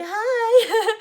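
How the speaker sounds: very high, laughing squeak of a hi; giggly hi. Retold or imitated. Meeting the client in his towel, giggling. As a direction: squeal hello through a nervous giggle.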